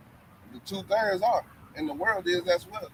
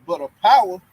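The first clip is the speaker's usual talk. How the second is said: slow, louder preaching read; scripture reading; 7 dB louder than usual. Acted. Reading Second Timothy aloud to the listeners, slow and louder. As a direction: proclaim the verse slowly and loudly.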